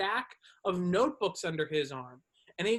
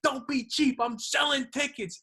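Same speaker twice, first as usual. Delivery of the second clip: high-pitched mock yelling; badgering ticket-seller's yell, half-strength; retold yelling; 6 semitones higher than usual. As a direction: imitate a pushy seller hollering. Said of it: "He's yelling at people to buy them".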